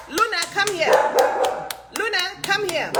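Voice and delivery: high sing-song call to the dog. Real, not acted. Calling the dog.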